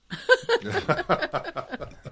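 loud high-pitched laugh. Real, not acted. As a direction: burst out laughing. Laughing at own joke.

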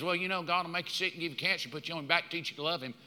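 quick, hushed parody of pat doctrine; mock glib teaching. Church folk explaining away sickness, mock glib and hushed. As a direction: toss it off low and soft.

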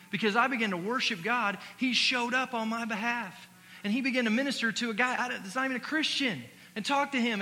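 loud, high preaching voice; fired-up pastor exhorting the church. Acted. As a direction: preach it out to the congregation. Preaching resumes after story.

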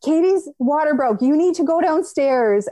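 high, urgent alarm; panicked wake-up call. Retold or imitated. Husband waking up to news of the birth, high and urgent. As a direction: blurt it out in a sudden panic.

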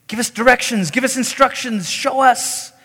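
high, insistent mock plea; pleading disciples. Disciples asking Jesus for directions, acted-out pleading.

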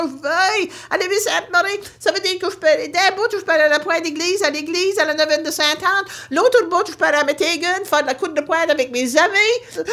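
high, fast delighted mother; thrilled mother. Retold or imitated. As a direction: rattle off every place gladly. (mère ravie, voix aiguë)

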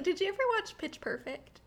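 ordinary talk, nothing marked

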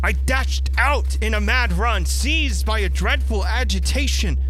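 breathless building alarm in the telling; rising panic. A narrator's reading. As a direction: read it like the dread is catching up with you. Narrator's panic rises as friend vanishes.

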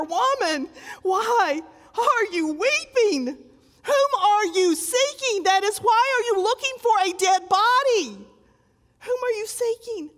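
high, searching question; preacher voicing Jesus at the tomb. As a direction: ask her tenderly but firmly. Jesus questioning the weeping Mary.

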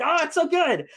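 high, loud squeal of delight; screaming joy at the screen; ecstatic gush. Retold or imitated. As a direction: rave like a thrilled fan. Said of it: "And I'm just like".

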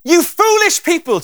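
high, loud and slow, scolding hard; harsh rebuke. Shown as a demonstration. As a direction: bark it out like an angry rebuke. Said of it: "the intonation of Jesus' voice".

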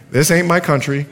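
chuckling through his own nervous thoughts; half-laughing, sheepish inner voice. Retold or imitated. Preacher mocking his own thoughts with a laugh. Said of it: "You know what I was thinking?".

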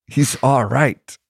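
slow, high mock Irish lilt; bad Irish accent. Old Irish guy repeating his reassurance, mock Irish accent. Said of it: "not doing a very good Irish accent".

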